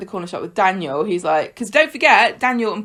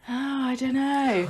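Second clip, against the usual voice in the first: doubtful groan; 5 semitones higher, 6 dB quieter than usual. Retold or imitated. Podcaster replaying her reaction to a slippery character, doubtful and soft. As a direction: let the doubt out on a long high sigh. Torn viewer mimics own reaction.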